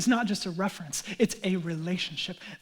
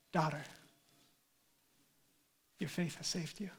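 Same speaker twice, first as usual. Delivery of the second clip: soft, low and gentle; tender; 3 semitones lower, 9 dB quieter than usual. Retold or imitated. Jesus to the healed woman, tenderly. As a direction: speak it quietly, with long tender pauses.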